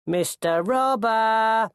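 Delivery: high, drawn-out hail; cheery bellow. A narrator's reading. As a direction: bellow up cheerily to a cornered robber. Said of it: "a cheery voice bellowed".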